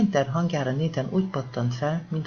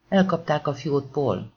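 ordinary delivery, nothing marked

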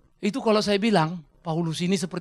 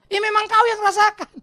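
high, loud exasperated concession; flustered doctor; 13 semitones higher, 8 dB louder than usual. Acted. Confused doctor answering the insistent patient, flustered. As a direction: give in loudly as a baffled doctor. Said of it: "dokternya pun mulai bingung kan".

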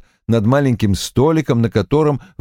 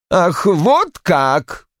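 slow spiteful hiss; angry hiss; 8 semitones higher, much slower than usual. A narrator's reading. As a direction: spit it out slowly, bitter. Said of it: "злобно прошипел Рой".

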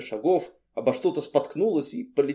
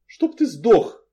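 high, pitched-up angry wail; higher-voiced outburst of fury; furious howl; 8 semitones higher, 6 dB louder, slower than usual. A narrator's reading. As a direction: howl the curse out. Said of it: "взвыла я".

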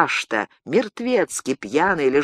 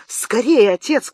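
high, louder urgent call; urgent shout; 6 semitones higher, 3 dB louder than usual. A narrator's reading. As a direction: rush her father out. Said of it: "крикнула девушка".

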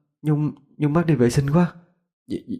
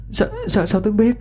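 very high, stammering disbelief; startled, pitched-up question; shocked girl; 12 semitones higher than usual. Acted. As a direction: stammer in alarmed surprise. Frightened girl, shocked by his knowledge.

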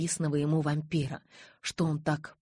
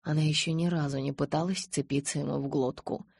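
plain reading voice

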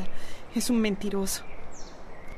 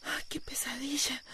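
breathy whisper to herself; barely voiced, still shaken; whispered relief; 16 dB quieter than usual. Woman just woken from a nightmare, whispering to herself.

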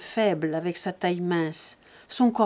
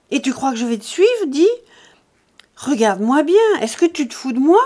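loud, high angry tirade; furious, scornful shouting; brutal outburst; 5 semitones higher, 9 dB louder than usual. A narrator's reading. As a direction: burst out brutally, voice raised and pitched up. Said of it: "la voix brutale, éclata".

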